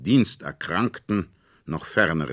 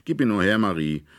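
demanding; 5 semitones higher, 6 dB louder, faster than usual. A narrator's reading. Fritz demands the toy.

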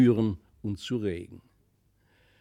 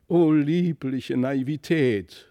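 high and slow, hammed-up swoon; drawn-out sentimental sigh of delight; lightly voiced grand lady swooning; gushing exclamation; 4 semitones higher, 4 dB louder, slower than usual. A narrator's reading. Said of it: "rief die dicke Dame".

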